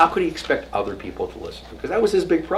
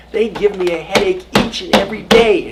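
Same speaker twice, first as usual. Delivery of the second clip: louder, higher mock exasperation; hammed-up Moses venting his frustration; exasperated complaint; 9 semitones higher, 9 dB louder than usual. Moses complaining to God about the people, mock exasperated outburst. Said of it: "and he says to God".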